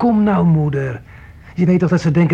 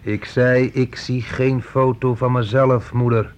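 slow menacing repeat; slower than usual. Acted. Son repeats missing photo complaint slowly.

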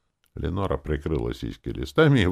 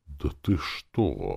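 slow, drawn-out disbelief; exclaiming; much slower than usual. A narrator's reading. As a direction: stretch it out in astonishment. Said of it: "воскликнул Нетлес".

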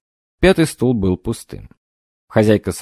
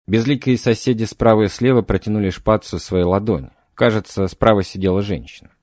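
usual speaking voice

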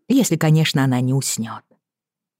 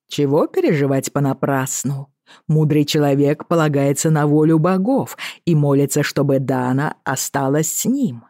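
casual lilting Irish sea captain; offhand, in a light Irish brogue; Irish accent. Acted. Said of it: "с певучим ирландским акцентом".

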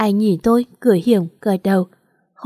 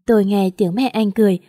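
plain reading voice

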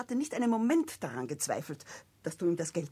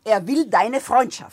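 loud, slow insistence; 3 semitones higher, 10 dB louder than usual. Acted. Esther pressing Victor.

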